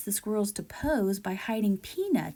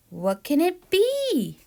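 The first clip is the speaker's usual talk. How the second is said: wondering squirrel; 8 semitones higher, 4 dB louder, slower than usual. Acted.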